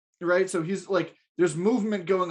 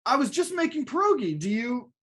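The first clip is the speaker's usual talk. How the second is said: high-pitched, hapless excuse-making tenant; meek tenant; 8 semitones higher than usual. Acted. Danny explaining himself to the landlord, high and meek. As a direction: offer a feeble excuse in a higher voice. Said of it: "I'm gonna be Danny".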